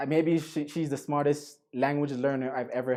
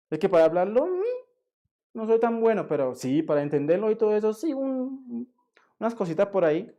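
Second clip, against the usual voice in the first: higher-pitched mock hedging; imitated excuse-making learner; 6 semitones higher, faster than usual. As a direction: imitate a student mumbling modest excuses. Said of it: "That's what they say all the time".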